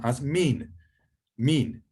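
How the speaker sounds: "min" said meen, with pizza's vowel; vowel demo. Shown as a demonstration. Teacher modelling Hebrew for from, "min" said meen. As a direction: say "min" with an Italian ee. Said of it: "you pronounce it e".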